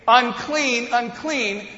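imitating a leper's high warning cry; loud yell. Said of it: "in a loud voice".

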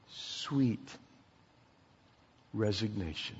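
soft, slow, with long pauses; tender exhortation. Real, not acted. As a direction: let the closing words sink in slowly and quietly. Tender closing exhortation of sermon.

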